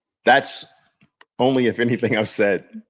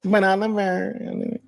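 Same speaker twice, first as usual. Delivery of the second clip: very high mock wail; put-on tearful doubter, drawn out; crying naysayer voice; 12 semitones higher, slower than usual. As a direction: whine the name like a crybaby. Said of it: "I always hear them in my mind like that".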